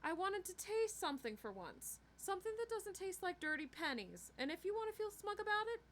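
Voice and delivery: high-pitched, heated defensive rant; hurt, angry self-justification; lashing out. A narrator's reading. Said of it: "Karen rarely lashed out at anyone".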